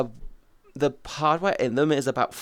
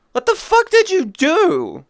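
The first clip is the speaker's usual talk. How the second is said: high, loud, slow disbelieving shout; real shocked cry; shocked outburst; 7 dB louder, slower than usual. Real, not acted. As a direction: yell it in stunned disbelief. Reacting to splint news.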